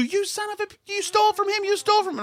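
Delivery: high, held-back imitation of an angry woman yelling; mock yelling. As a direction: mimic her outraged shrieking without full volume. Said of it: "joins in and starts yelling too".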